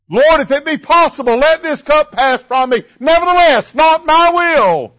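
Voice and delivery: high, loud, building plea; fervent prayer cry. Acted. Preacher modelling prayer to the Lord, fervent cry. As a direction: cry it out with a preacher's full force.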